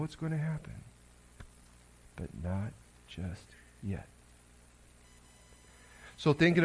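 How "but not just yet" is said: slow, soft and drawn out, leaning on not; hushed and slow. Acted. To the congregation on the waiting of Advent, hushed and slow. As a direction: let it sink in quietly, holding back.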